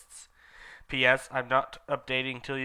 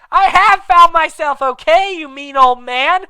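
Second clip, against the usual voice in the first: much higher and louder yell in a put-on voice; Hagrid yelling; 14 semitones higher, 15 dB louder than usual. Hagrid yelling furiously at Dumbledore. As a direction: scream it at the old man. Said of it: "Hagrid yelled".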